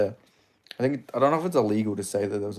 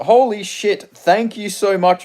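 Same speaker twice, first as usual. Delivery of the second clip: louder, higher burst of thanks; thrilled at an unexpected gift; real delighted surprise; 7 semitones higher, 6 dB louder, slower than usual. Real, not acted. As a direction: blurt out your amazed gratitude. Surprised by a viewer's donation.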